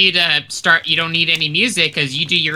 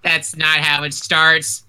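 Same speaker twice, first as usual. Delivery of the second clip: ordinary talk, nothing marked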